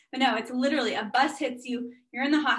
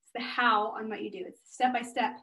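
ordinary talk, nothing marked

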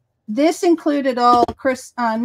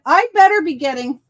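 joking demand; 6 semitones higher, 6 dB louder than usual. Retold or imitated. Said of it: "I said".

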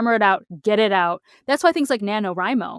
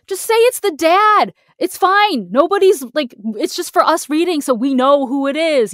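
louder, higher, pleading with the writer; just tell us it's the dad, fed up; exasperated plea; 7 semitones higher, 7 dB louder than usual. Retold or imitated. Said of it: "and you're like".

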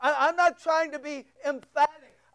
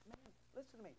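much softer, breathy mock aside; mock whisper; 5 semitones lower, 29 dB quieter, much faster than usual. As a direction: mimic a soft-talking pastor in a hush. Mocking pastor who wants comfort.

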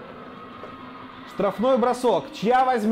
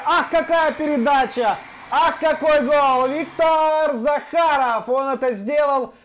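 excited hockey goal call; 6 semitones higher, slower than usual. Real, not acted. Admiring goal and pass.

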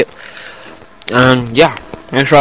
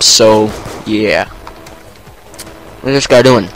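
ordinary talk, nothing marked

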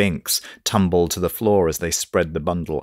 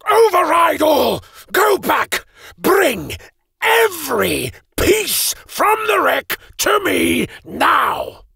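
raging shout; slower than usual. Acted. Mage rages at golems, shouting high commands. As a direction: roar orders at the golems. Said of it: "raging at the grey automatons".

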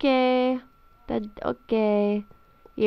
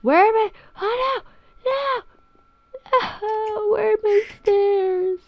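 mock panic; 11 semitones higher than usual. Teleported, panics over lost house.

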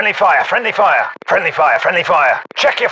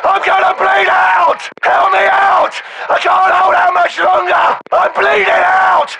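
very high, frantic yell; wounded soldier's all-out plea; desperate screaming; 11 semitones higher than usual. Acted. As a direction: scream for help as if bleeding out. Bleeding soldier screams for help.

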